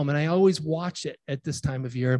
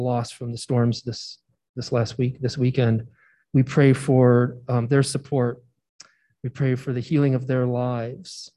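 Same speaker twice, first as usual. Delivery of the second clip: earnest, measured prayer voice, slowing on the pleas; spoken prayer; 4 semitones lower than usual. Acted. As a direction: lead the congregation gently in prayer. Pastor leads congregation prayer.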